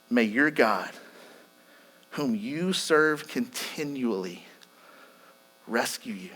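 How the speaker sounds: low, soft and slow, with long pauses; hushed confiding. Retold or imitated. King to Daniel at the pit's edge, hushed. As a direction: lean in close and murmur it gravely. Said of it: "leaning in and whispering in Daniel's ear".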